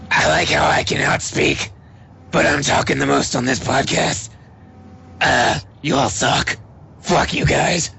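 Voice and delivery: raspy smoker's growl; rough, smoke-worn voice. Pretend. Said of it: "I guess, like, a smoker voice?".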